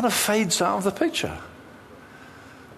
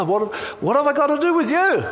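demoniac's outcry; 9 semitones higher than usual. Acted. Possessed man crying out at Jesus in alarm. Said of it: "That's what Legion means".

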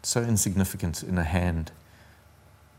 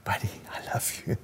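unvoiced whisper, much softer; 8 dB quieter than usual. The Lord answering the troubled dreamer, whispered. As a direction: breathe it like a secret. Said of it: "he whispered".

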